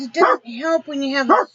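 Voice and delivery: ordinary talk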